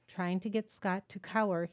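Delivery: ordinary delivery, nothing marked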